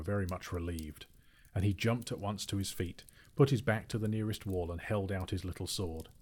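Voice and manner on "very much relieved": ordinary delivery, nothing marked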